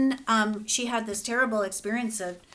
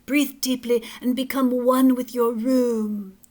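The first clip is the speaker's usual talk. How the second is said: calm therapist voice; 3 semitones higher, 6 dB louder than usual. Acted. Therapist guiding relaxation.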